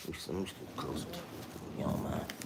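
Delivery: hushed, close to the mic; whisper. Real, not acted. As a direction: drop to a near-whisper up close. Leans to mic, speaks softly.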